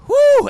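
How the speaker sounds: very high, loud whoop of relief; big whooped exhale; exclaimed whew. Acted. Storyteller after the doll dies, loud high whew. Relief once Chucky is dead.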